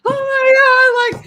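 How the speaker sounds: high, drawn-out mock panic; freaking out. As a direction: imitate a friend losing it. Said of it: "He was freaking out".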